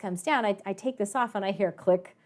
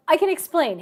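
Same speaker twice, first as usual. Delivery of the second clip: high, louder hurried excuse; pleading; 8 semitones higher, 9 dB louder than usual. Retold or imitated. Caught student pleads with the professor, high and loud. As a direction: beg to be heard out.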